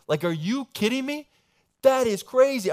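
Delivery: real wonder, pitched high; excited disbelief. Real, not acted. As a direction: marvel out loud at the scene. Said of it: "preacher's own amazement".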